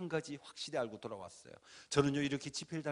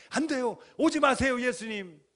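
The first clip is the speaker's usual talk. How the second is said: loud, much higher pleading cry; shouting in panic; 6 semitones higher, 9 dB louder than usual. Retold or imitated. Preacher begging Jesus not to return, shouting. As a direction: beg Jesus not to come, yelling. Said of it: "막 소리쳤어요".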